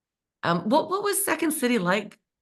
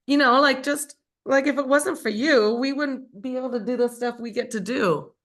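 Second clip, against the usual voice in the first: real tears rising, voice pushed higher; higher, teary and fighting to get it out; weepy; 7 semitones higher than usual. Real, not acted. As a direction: speak through welling tears. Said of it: "and I'm, I'm weepy".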